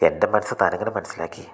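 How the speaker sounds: ordinary delivery, nothing marked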